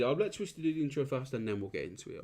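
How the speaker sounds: ordinary delivery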